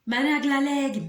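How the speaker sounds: exasperated cry of "akulaleki"; frustrated outburst. Retold or imitated. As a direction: blurt it out, fed up and boiling. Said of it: "I'm brewing, like, ngiyabila. I'm boiling".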